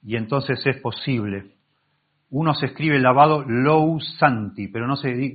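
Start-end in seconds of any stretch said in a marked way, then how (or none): 3.5-4.7 "lou santi" said slowly with both vowels, o and u; spelled-out pronunciation. Shown as a demonstration. Teacher spelling out the Greek word for washed, slow and deliberate. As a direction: sound out "lou santi" as written, O before U. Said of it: "no se pronuncia lou santi".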